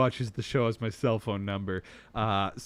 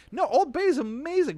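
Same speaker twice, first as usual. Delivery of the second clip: high, emphatic rave; excited praise; 5 dB louder than usual. Real, not acted.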